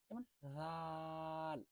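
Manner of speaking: slow soft drawn-out রাল; stretched soft letter. Shown as a demonstration. Teacher giving a soft example letter. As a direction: ease রাল out gently and slowly. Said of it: "উচ্চারণটা একেবারে soft হবে".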